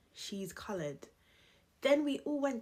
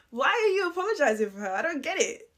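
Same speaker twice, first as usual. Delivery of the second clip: baffled exasperation; 4 semitones higher, 7 dB louder, faster than usual. Real, not acted. Baffled laugh at the apology.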